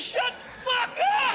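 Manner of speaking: high, slow, stretched-out rage; mock furious yell. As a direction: scream it at the kitchen TV. Idiot raging at the TV.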